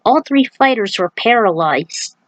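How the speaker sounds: ordinary delivery, nothing marked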